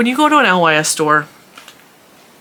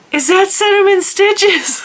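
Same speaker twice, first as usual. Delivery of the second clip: much higher, slow and a bit louder, thrilled recognition; excited fan; 4 dB louder, slower than usual. Retold or imitated. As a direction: gasp it out like a starstruck fan. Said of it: "And she's so excited".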